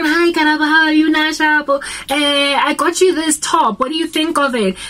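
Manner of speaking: high, bright-voiced fake niceness; sugary sweet greeting. Family member sweetly offering Karabo a gift after yelling. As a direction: coax her with a sugary peace offering.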